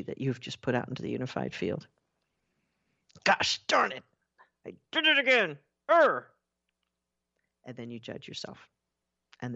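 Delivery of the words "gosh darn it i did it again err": high, exasperated mock outburst; self-frustrated outburst. Speaker voices someone catching their own slip, mock exasperated. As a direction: act out being cross with yourself, pitched up.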